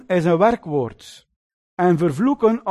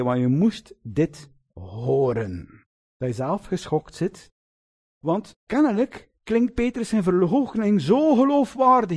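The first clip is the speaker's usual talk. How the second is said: usual speaking voice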